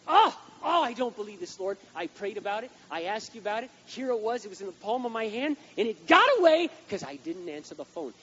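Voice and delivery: loud, high wail of regret to the Lord; hammed-up despair crying out to God; obsessing over the lost car, loud mock lament; mock anguish. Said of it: "you're sitting there going".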